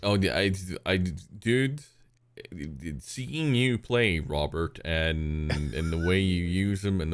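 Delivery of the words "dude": usual speaking voice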